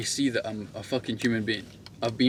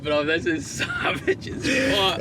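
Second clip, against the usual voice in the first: laughing disbelief; 5 semitones higher, much slower than usual. Retold or imitated. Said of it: "I'm like".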